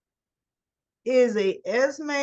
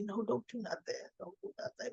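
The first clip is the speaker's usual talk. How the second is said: hushed plea; 14 dB quieter, faster than usual. Retold or imitated. What Valentine wanted to say.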